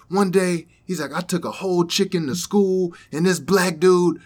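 high, loud excited retelling voice; imitating the guy. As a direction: tell it like the giddy young guy.

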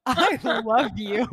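over-earnest, drawn-out "I love you"; insistent fan. Retold or imitated. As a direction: press each word of "I love you" with mock intensity. Re-enacting insisting again.

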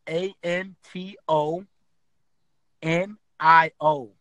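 slow, letter by letter; spelling it out. Shown as a demonstration. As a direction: spell each letter out clearly. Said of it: "spelling name out slowly".

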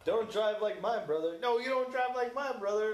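a hammed-up radio car guy; Car Talk brothers quote. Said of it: "Car Talk guys quote from Cars".

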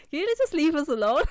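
high-pitched, giggly imitation; cracking up while quoting; laughing mock plea. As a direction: mimic the rude request through laughter. Laughs while mocking Bella's rudeness.

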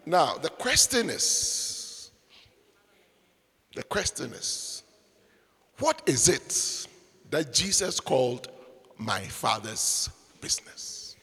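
slow, soft, dropping to a whisper; hushed question. Acted. Preacher hushing congregation before the key question. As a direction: lean in and hush the question.

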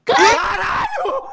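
loud, drawn-out laughing yell; stunned laughing shout. Real, not acted. Friend guesses the dog right.

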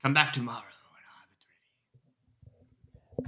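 soft, slow whisper. Acted. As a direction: hush it like a secret parting word. Shopkeeper whispers dismissal.

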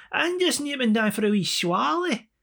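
high, slow sing-out in Scottish; broad Scottish accent. Retold or imitated. [name] explaining to baffled listener, exaggerated Scottish accent. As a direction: stretch it out in thick mock Scottish. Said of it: "Kevin Bridges type Scottish".

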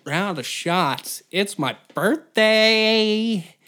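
loud, high, dragged-out mock party guy; a grown man mockingly bellowing for birthday shots; mocking birthday boy. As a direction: ham up the self-important birthday brat. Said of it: "and you're like".

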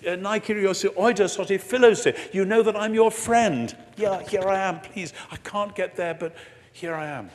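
pleading Peter. Retold or imitated. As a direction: plead as Peter who can't get there. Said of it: "Peter still can't say it".

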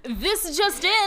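slow, hammed-up anchor open; mock breaking news. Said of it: "sounded like a news anchor".

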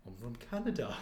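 low, soft put-on voice; odd-sounding double. Odd double tells host where he's from, low soft voice. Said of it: "why do you sound like that".

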